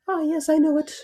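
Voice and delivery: very high, loud wolf voice; Big Bad Wolf. Wolf greets Red on the path, high creepy voice.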